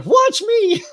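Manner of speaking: high-pitched show-off holler; loud, proud call to your daughter; yelling. Retold or imitated. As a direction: re-enact an eager shout for an audience. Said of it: "of course I'm yelling too".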